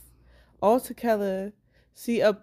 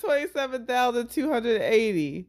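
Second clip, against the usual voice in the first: laughing; 6 semitones higher, 6 dB louder than usual. Real, not acted. Struggling through numbers, laughing.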